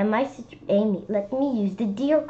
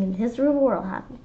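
ordinary delivery, nothing marked

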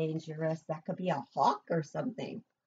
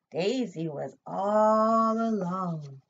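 slow; 5 dB louder, much slower than usual. A narrator's reading. Suspense in story.

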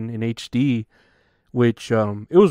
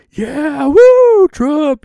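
crowd cheering; 10 dB louder, much slower than usual. Retold or imitated. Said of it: "They're like".

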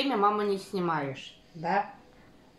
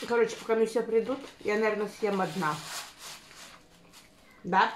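food in mouth; speaking between bites; chewing while talking. Real, not acted. Seen 2: Woman bites food at -3, then talks and chews with bulging cheeks.

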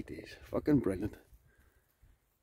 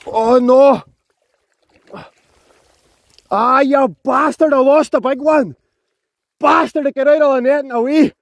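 loud, high cursing at the lost fish; furious shout; 11 semitones higher, 7 dB louder than usual. Real, not acted. Angler cursing the fish that escaped, furious shout. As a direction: yell in real anger after losing the big one.

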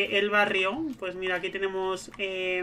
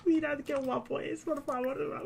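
swooning gush; 5 semitones higher, 6 dB quieter, slower than usual. Real, not acted. Admiring a handsome neighbour.

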